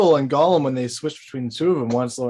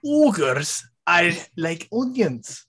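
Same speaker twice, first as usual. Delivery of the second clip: Scottish Shrek voice; slower than usual. Retold or imitated. Shrek quote.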